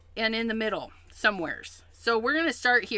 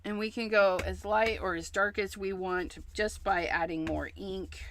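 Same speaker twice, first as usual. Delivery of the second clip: ordinary delivery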